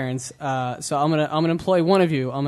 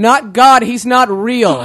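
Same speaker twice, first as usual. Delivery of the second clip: slow, raised, emphatic mock-kid shout; blurted punchline; 5 semitones higher, 7 dB louder, slower than usual. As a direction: blurt it out loud to the parents. Kid's punchline to religious parents.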